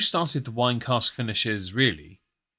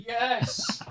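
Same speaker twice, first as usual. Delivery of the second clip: mock cheer; 11 semitones higher, 4 dB quieter than usual. Retelling own cheer.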